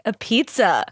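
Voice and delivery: ordinary talk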